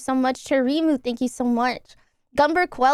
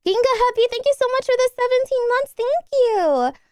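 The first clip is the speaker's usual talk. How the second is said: excited fast thanks; 11 semitones higher than usual. Real, not acted. High fast subscriber thanks.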